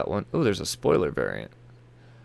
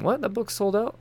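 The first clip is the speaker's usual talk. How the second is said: higher disbelieving question; surprised; 11 semitones higher than usual. Real, not acted. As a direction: sold out? rising in disbelief. Surprise at a sellout.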